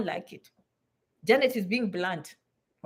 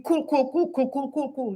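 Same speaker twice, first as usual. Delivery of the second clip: mild mock of trendy chatter; mocking cool cool. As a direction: imitate a laid-back young slang speaker. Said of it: "That is stupid".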